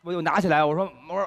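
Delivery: ordinary talk, nothing marked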